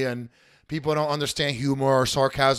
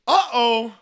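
"Uh-oh." pitched high and a bit louder; 8 semitones higher, 5 dB louder than usual. Pretend. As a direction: lift the "Uh-oh." up high, teasing. High uh-oh second take.

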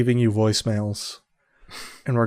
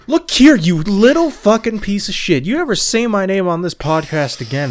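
loud, high hammed-up tirade; angry threat; 6 semitones higher, 10 dB louder than usual. Co-host warning the host about naming him, hammed-up angry yell. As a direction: launch into a shouted mock threat, starting high and coming down.

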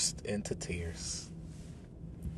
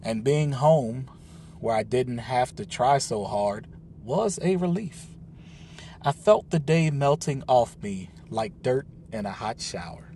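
usual speaking voice

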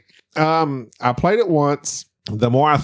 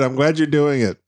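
usual speaking voice

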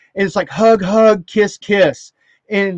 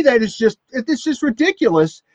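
ordinary talk

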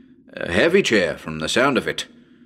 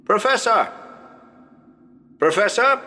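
shouting a name; 10 semitones higher than usual. Acted. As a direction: call out to someone out of sight. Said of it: "shout to the professor".

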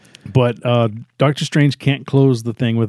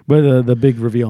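normal talking voice

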